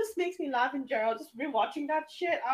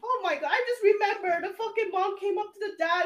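usual speaking voice

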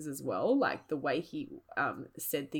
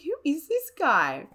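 incredulous; 9 semitones higher, 6 dB louder than usual. Retold or imitated. Disbelief at date's comment.

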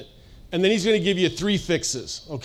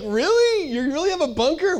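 mock disbelief, jumping high on "Really?"; playfully amazed question; incredulous; 10 semitones higher than usual. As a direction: retell your own wide-eyed surprise. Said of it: "And I'd be like".

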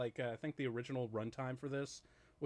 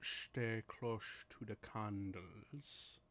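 slow, hushed, drawn-out warning; very slow, quiet spooky quote; eerie film impression; 2 semitones lower, 7 dB quieter, much slower than usual. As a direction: draw it out softly and ominously. Quoting the film's eerie line.

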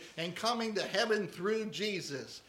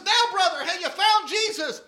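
much higher, louder pressing preacher; preacher pushing the drunk again; 11 semitones higher, 12 dB louder than usual. Acted. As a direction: press him hard like an impatient preacher. Preacher after longer dunking.

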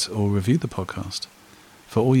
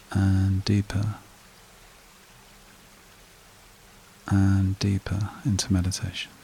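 slow, calm meditation voice with long pauses; guided meditation; 6 dB quieter, slower than usual. Acted. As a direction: lead a breathing exercise, unhurried, leaving long silences.

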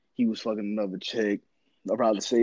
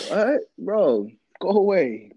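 higher, louder mock-indignant brush-off; exasperated dismissal; 7 semitones higher, 5 dB louder, slower than usual. Host waves off someone's handwashing advice, exasperated dismissal. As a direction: shoo them away in loud disbelief.

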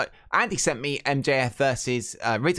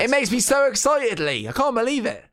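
squealing with high-pitched excitement; excited; 10 semitones higher, 4 dB louder than usual. Acted. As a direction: gush like a giddy fan. Gushing about gross death matches.

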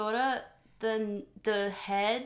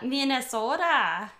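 put-on loud, higher-pitched Minnesotan accent parody; mock Minnesotan accent; 4 semitones higher, 5 dB louder than usual. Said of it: "making fun of, like, the accent".

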